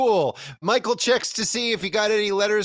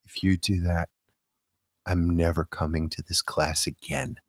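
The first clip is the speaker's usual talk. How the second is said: put-on girl warning the art teacher in a low threat; menacing Connie; 13 semitones lower than usual. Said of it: "And Connie's like".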